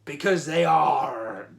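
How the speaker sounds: hammy pirate with a drawn-out "arr"; terrible pirate accent. Pretend. Host telling a pirate joke, terrible pirate accent. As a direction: draw the punchline out like a stage pirate. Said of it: "I'm gonna be doing my terrible pirate accent".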